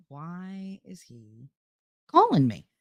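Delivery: slow, drawn-out exasperated question. Retold or imitated. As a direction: stretch each word in weary disbelief. Retold exasperation at late call.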